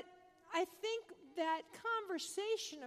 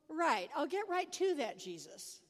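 sarcastic; 2 semitones higher, 5 dB louder than usual. Acted. Preacher answering Jesus on being perfect, sarcastic. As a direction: feign compliance, then drop the pitch drily.

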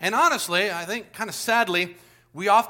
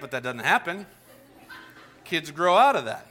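usual speaking voice

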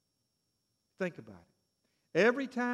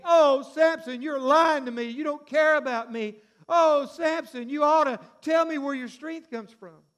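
higher, louder, pleading woman's whine; begging Delilah; 7 semitones higher, 9 dB louder than usual. Retold or imitated. Delilah begging Samson for his secret, higher pleading. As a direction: beg him to tell, drawing out the oh.

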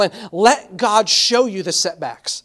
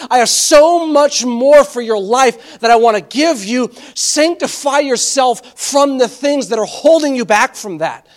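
raised, louder sermon delivery; impassioned preaching; 4 semitones higher, 4 dB louder than usual. Acted. Preacher voicing God's promises to the congregation, impassioned. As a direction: press each promise home with rising force.

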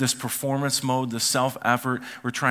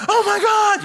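very high, loud mock shriek; hammed-up panicked outcry; overreacting mother; 7 dB louder than usual. Mother hears her son crying, mock-hysterical overreaction. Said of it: "she does overreact a little bit".

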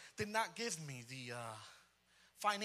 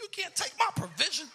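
much higher, loud, insistent; stomping rebuke; 12 semitones higher, 9 dB louder than usual. Retold or imitated. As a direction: drive it home to the devil. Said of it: "I was stomping everything".

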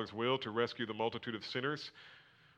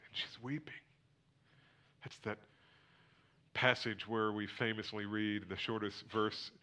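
normal talking voice

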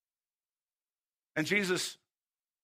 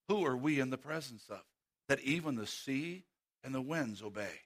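hushed storytelling; 7 semitones lower, 7 dB quieter than usual. Acted. Preacher telling how Jesus calmed the sea, soft and low. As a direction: let the voice sink to calm.

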